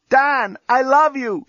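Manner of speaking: high-pitched shouted declaration; woman calling out, voice way up; shouting. A narrator's reading. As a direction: shout your love to a distant cell. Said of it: "shouted Lucy from her cell".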